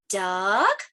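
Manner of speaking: DUCK drawn out with a rising question tune. Acted. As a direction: ask "Duck?", sliding up at the end. Book guesses at each bird.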